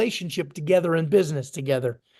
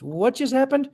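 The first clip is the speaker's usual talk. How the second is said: higher-pitched retold bewilderment; confused; 6 semitones higher than usual. Young seller phoning his buyer, imitated confusion. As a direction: act out puzzled disbelief, pitch lifted. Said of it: "I'm kinda confused".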